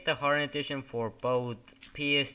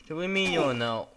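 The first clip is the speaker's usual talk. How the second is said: mom scolding; 5 semitones higher, 7 dB louder, faster than usual. Retold or imitated. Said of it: "I'm like".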